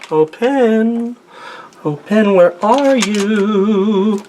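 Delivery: high, drawn-out plea to a lost pen; sing-song calling. Pretend. Artist searching his desk, calling his pen in a high slow voice. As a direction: coax the pen out, very slowly.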